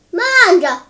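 loud, sudden halt order; commanding call. A narrator's reading. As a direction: call out sharply to stop him. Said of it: "一个声音叫道". (大声喝止)

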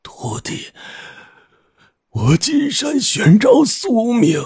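put-on feeble old man straining out whispered words, slow and breaking off; weak, unwilling cry. A narrator's reading. Dying old master calls to his disciple, weak and unwilling. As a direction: cry out with failing breath, gasping between phrases. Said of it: "胡老道虚弱而不甘地喊道".

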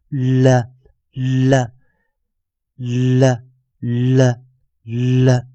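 "了" as a dark L with a short schwa, repeated slowly; dark L demo. Shown as a demonstration. Teacher modelling the dark L for students, slow repeated drill. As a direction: model the heavy "了" sound one at a time. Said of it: "我们就是念了".

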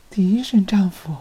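stunned breathless whisper; bewildered whisper. A narrator's reading. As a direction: whisper it, caught off guard. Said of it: "米莱迪有些不知所措".

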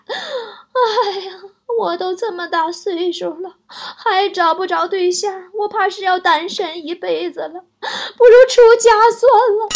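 hammed-up whiny wail, pitch up; mock self-pity. Playing the pitiful spinster to parents, hammed-up fake crying. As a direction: fake crying, slow, rising to a loud wail. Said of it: "主动卖惨".

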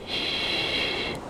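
soft breathy shush; quiet him with a shh; hushing shh. A narrator's reading. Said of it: "他说，并且将他的手指轻轻地放在我的嘴唇上".

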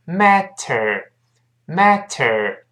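"matter" very slowly, full t and curled r. Shown as a demonstration. Teacher breaking the word down, very slow "matter". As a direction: stretch out "matter" syllable by syllable, MAT-ter. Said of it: "读非常慢是".

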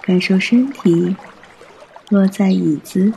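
normal talking voice

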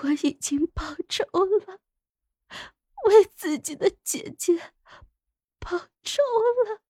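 high, weak, gasping sobs; tearful dying voice. Acted. Dying consort avenged for the sister, high breathless tearful voice. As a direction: break the words up with failing breath.

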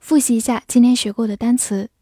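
ordinary talk, nothing marked

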